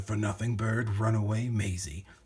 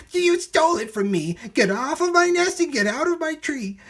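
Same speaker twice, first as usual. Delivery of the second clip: very high, loud scolding bird voice; furious bird; 7 dB louder than usual. A narrator's reading. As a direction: drive him off the nest in a fury. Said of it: "she sputtered".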